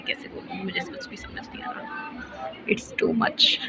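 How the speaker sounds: hushed, helpless whisper; breathless whisper of confusion. Real, not acted. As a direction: whisper it so nobody around hears. Confused, can't speak out loud.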